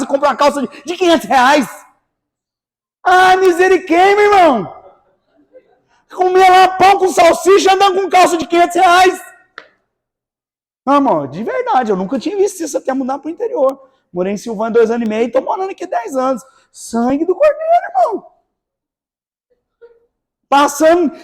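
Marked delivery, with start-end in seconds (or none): 3.0-9.2 louder, outraged disbelief at the waste; loud exclamation. Real, not acted. Pastor to congregation, outraged preaching exclamation. 16.7-18.3 much higher, a startled cry; shocked exclamation. Real, not acted. Pastor to congregation, high-pitched shocked exclamation.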